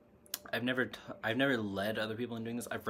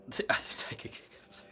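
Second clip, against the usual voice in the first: whispering. Real, not acted. Aside about hiccups.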